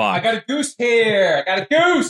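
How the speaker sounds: hammed-up New York guy with a goose; New York accent. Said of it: "Is that a New York accent?".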